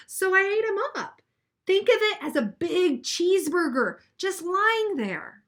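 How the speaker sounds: put-on expressive read-aloud for children; reading a picture book to a class; story narration. A narrator's reading.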